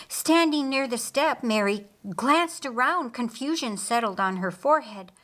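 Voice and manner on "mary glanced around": ordinary delivery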